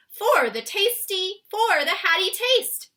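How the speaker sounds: high, loud, slow giant cry; BFG crying out. Acted. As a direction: cry it out like the big friendly giant. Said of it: "cried the B F G".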